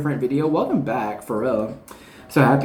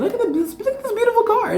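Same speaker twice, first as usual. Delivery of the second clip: much higher, gushing with a laugh; delighted gushing; faster than usual. Real, not acted. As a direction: swoon over the card, laughing. Gushing over a beautiful card.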